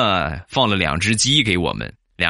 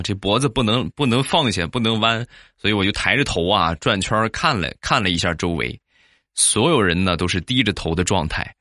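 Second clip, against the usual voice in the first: usual speaking voice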